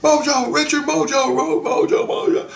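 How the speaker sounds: high-pitched mock screaming chant; frantic high retold cries; imitated crowd scream. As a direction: yell it high, like a panicked crowd calling out. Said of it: "everybody's screaming Mojo".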